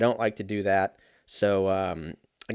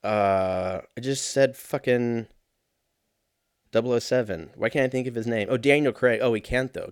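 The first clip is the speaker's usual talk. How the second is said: ordinary delivery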